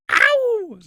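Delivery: a sudden high pained "Ow!"; sharp yelp. Retold or imitated. Pastor hit from behind, yelping in pain.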